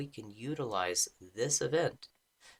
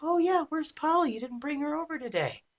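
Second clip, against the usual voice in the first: much higher, chirpy friend asking; imagined friends asking; 5 dB louder than usual. Retold or imitated. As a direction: pitch way up as a cheery acquaintance. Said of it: "They're gonna be like".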